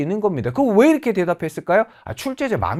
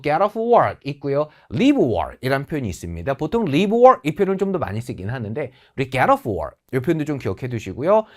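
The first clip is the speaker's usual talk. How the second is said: usual speaking voice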